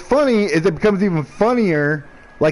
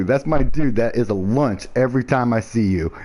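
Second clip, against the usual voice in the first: ordinary talk, nothing marked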